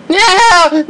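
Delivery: ordinary talk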